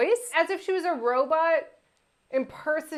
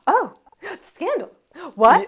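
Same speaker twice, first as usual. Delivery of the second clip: higher, gasping scandalized belle; mock Southern Belle gasp; 5 semitones higher, 6 dB louder than usual. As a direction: clutch your pearls like a Southern belle. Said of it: "[name] doing her Southern Belle".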